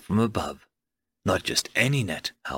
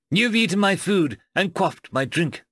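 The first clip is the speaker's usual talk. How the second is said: high-pitched, formal declaration; declaring; 7 semitones higher than usual. A narrator's reading. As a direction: proclaim your claim over a captive. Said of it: "he declared".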